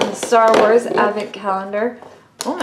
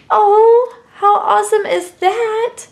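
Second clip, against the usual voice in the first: much higher, delighted exclamation; thrilled host marvels at a cute toy nest; excited delight; 9 semitones higher, 5 dB louder, slower than usual. Real, not acted. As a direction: gush over the new toy. Reacting to pink nest toy.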